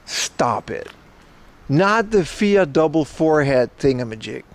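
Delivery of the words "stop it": usual speaking voice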